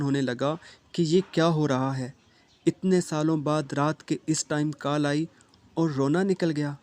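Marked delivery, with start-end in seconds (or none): none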